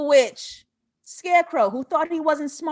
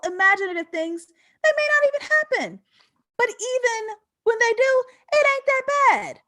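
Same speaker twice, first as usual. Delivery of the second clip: rising preacher fervor; 9 semitones higher than usual. Real, not acted. Pastor-like speaker to viewers, building fervor. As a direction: preach it, building to a crying peak.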